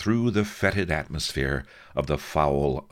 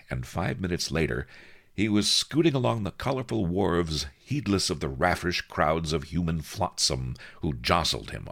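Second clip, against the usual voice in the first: usual speaking voice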